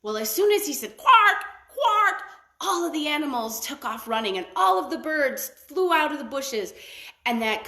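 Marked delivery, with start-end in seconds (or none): 1.0-2.2 high loud squawking quark; shrill bird cry, high and loud; bird call. A narrator's reading. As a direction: squawk it like a fish eagle. Fish eagle's call in story.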